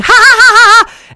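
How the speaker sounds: high, loud cackling game show laugh; mock shrieking laugh. Imitating the game show cast, loud high mock laughter. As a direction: cackle like a hysterical studio crowd. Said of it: "they were laughing".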